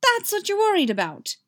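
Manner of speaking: loud, very high squeak that falls away; indignant squeak. A narrator's reading. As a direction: squeak it in disbelief. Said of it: "he squeaks".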